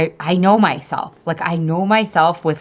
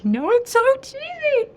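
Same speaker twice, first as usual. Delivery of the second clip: very high, squeaky aside; high-pitched self-mocking admission; sheepish squeal. Real, not acted. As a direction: squeal it, embarrassed by your own cheesiness. Sheepish aside about her mantra.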